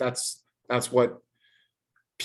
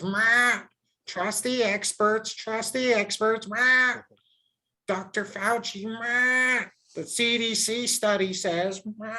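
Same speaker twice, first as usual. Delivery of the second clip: high, slow squawking parrot voice with "meh" bleats; 9 semitones higher, slower than usual. Retold or imitated. Mocking people who repeat experts, high squawking parrot imitation. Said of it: "squawking parrots, dude. C N N parrots".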